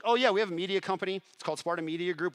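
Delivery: fast, high, loud salesman enthusiasm; eager quick pitch. Retold or imitated. Entrepreneur improvising a media company for his sponsor, eager quick pitch. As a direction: jump on the opportunity, rattling it off brightly.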